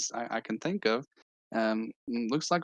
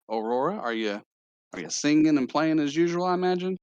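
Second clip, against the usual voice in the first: higher, louder character greeting; game character voice; 7 semitones higher, 4 dB louder than usual. Acted. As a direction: ask in a lifted put-on voice. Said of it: "I had this voice prepared".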